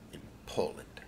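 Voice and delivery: whispered aside; a hushed reveal to the audience; secret whisper. Acted. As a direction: breathe it like a spy's secret. Spy secret revealed in narration.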